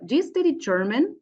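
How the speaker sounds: quick yes-no question, rising on "German". Shown as a demonstration. Teacher demonstrates yes or no questions, voice rising on German. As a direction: lift the pitch up through "German". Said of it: "we use a rising tone".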